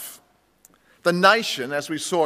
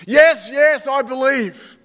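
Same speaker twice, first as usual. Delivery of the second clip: high, loud, eager yes; 7 semitones higher, 5 dB louder, slower than usual. Acted. Man on the cliff answering God, high and loud. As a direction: blurt it out, desperate to agree.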